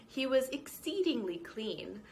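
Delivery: ordinary delivery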